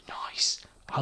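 soft whispered nice. Real, not acted. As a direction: breathe it out quietly. Whispered approval.

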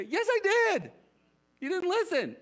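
very high, loud mock yell; hammed-up parental outburst; exasperated parent. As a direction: shriek back at the kid's excuse. Exasperated parent answering child, acted.

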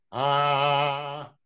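sustained sung ah in chest register; sung chest-voice ah demonstrated for a student. Shown as a demonstration. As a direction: hold a full chest-voice ah. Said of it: "Pecho es".

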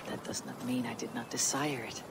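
soft, breathy whisper of longing; whispered confession. Acted. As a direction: confess it under your breath. Intimate confession of love.

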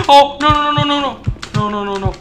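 high, frantic string of no's; freaking out. Real, not acted. Player fails to get out, freaking out. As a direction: panic building to a loud scream. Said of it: "I'm freaking out".

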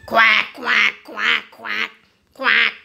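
a hint of a noisy duck; mild duck shout. A narrator's reading. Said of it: "shouts Dotty".

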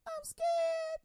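a neighbor squealing in pretend fear; high comic frightened squeak; mock scared. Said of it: "your neighbor chimes up".

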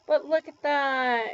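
normal talking voice

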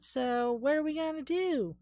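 high-pitched put-on Southern accent. [name] asks what they can do, southern accent. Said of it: "why I did a southern accent".

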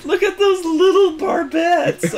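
high, cooing condescension; mock patronising praise. American visitor in an Iowa cap teasing Belfast's crew, high cooing condescension. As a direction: coo at it like a baby's toy. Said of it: "It's like".

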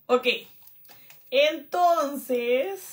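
ordinary talk, nothing marked

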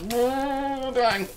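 drawn-out high mock wail. Gamer wailing that the team is dying, high and drawn out.

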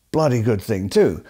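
usual speaking voice

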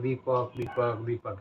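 a soft echo trailing off. Shown as a demonstration. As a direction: let the name die off into the distance. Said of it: "સામેથી અવાજ આવશે".

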